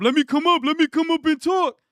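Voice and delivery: high mocking voice of a begging viewer; mocking whiny beggar. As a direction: mimic a needy kid begging to come on.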